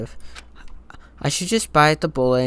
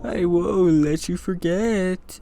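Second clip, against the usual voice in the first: slow, higher-pitched eerie wail; spooky, raised, lingering voice; haunting ghostly voice; 5 semitones higher, much slower than usual. Acted. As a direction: draw it out like a haunting spirit. Voices haunting game threat.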